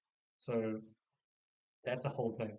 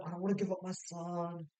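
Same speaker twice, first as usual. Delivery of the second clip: higher-pitched plaintive imitation; mock whining; 4 semitones higher than usual. Anna refusing to give up her son, mock whining. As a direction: whine it like a reluctant mother.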